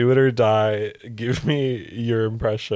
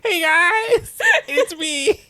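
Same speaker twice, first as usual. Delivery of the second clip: much higher, laughing nerdy voice; mock nerdy voice; 4 dB louder, much slower than usual. Teasing her friend with a high, laughing nerd voice. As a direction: squeak it out like a giggling nerd. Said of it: "Oh, gee, guys".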